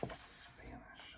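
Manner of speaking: soft unvoiced whisper. Real, not acted. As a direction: breathe the word like a secret. Aside to class after answer.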